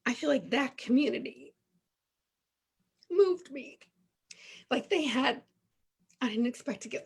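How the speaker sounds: choked up. Real, not acted. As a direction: voice catching with emotion. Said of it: "I get all choked up".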